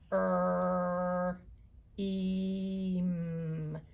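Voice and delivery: er and eem drawn out long; stretched sounds. Shown as a demonstration. Teacher sounding out scream for children. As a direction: hold each vowel sound of scream. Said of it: "just writing the sounds I hear".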